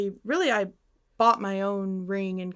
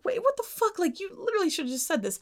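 much higher, stunned outburst; shocked disbelief; 8 semitones higher, faster than usual. Retold or imitated. Girlfriend reacting to boyfriend's sudden blowup, shocked and higher. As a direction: caught off guard, reliving the shock. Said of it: "like, shocked".

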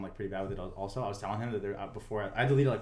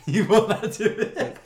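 laughing slowly, higher and louder; 10 semitones higher, 9 dB louder, slower than usual. Real, not acted. Laughing while repeating it to friends, louder and higher.

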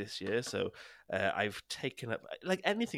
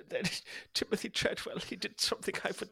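high, hammed-up German accent; 9 semitones higher, faster than usual. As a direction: put on a big comic German accent, pitched up. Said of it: "done the German accent".

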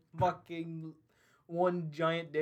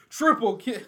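giant announcer voice; 4 semitones higher, 8 dB louder than usual. Retold or imitated. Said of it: "the giant announcer voice".

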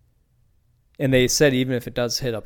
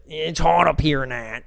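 a rough attempt at a Pittsburgh accent, higher; lightly put-on Pittsburgh accent, mock complaining; 4 semitones higher, 4 dB louder, slower than usual. Steelers fan griping about the heat, in a Pittsburgh accent. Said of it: "I can't do the Pittsburgh accent very well".

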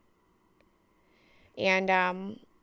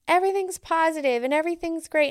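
higher, louder, fast, mock-bright gushing; mock cheery; 8 semitones higher, 5 dB louder, faster than usual. Mocking people who say everything is positive, in a bright, fast, sing-song voice. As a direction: parody the toxic positivity crowd.

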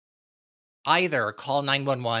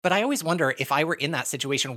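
ordinary talk, nothing marked

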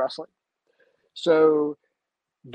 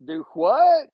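much higher, louder disbelief; stunned do what; 13 semitones higher, 8 dB louder than usual. Retold or imitated. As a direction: blurt it out in shock. Shocked at promotion news.

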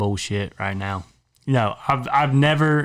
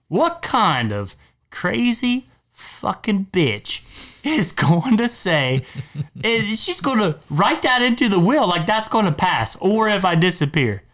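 outraged rant; 8 semitones higher than usual. Real, not acted. Outraged rant about the will.

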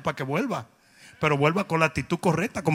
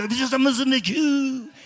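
slow, higher mock French accent; Pepe Le Pew; 5 semitones higher, 4 dB louder, slower than usual. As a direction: drawl like a cartoon Frenchman. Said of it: "Pepe Le Pew".

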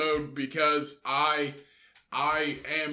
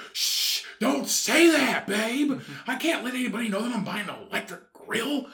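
high, mock-panicked hush; embarrassed shushing; 7 semitones higher than usual. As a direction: shush your partner in comic alarm. Embarrassed man shushing his girlfriend.

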